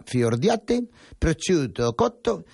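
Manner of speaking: reading the ingredients with a hammed-up Italian accent, a touch of Galician; half-joking Italian pronunciation of the cheeses and ham; mock Italian accent. Radio host reading a pizza recipe to his co-host, in a mock Italian accent. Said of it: "Te está saliendo más acento gallego que italiano".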